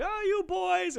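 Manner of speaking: high, loud mock-fond cooing; put-on playful front. Aunt keeping up her front for the boys, put-on playful front. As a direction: imitate an over-sweet lady teasing boys. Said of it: "totally put on this whole front".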